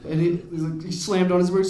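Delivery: ordinary talk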